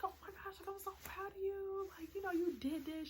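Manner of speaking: high-pitched imitation of an overcome manager; acting out a crying, thrilled boss; tearful proud manager. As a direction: gush with pride, voice way up high. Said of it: "She started crying".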